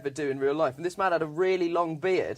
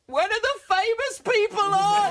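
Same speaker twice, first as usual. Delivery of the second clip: a much higher, louder whiny heckle; disappointed heckler; 4 dB louder than usual. Retold or imitated. Fan at the back heckles the unknown comic, high whiny heckle. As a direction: plead like a let-down fan at the back. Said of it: "a man just at the back went".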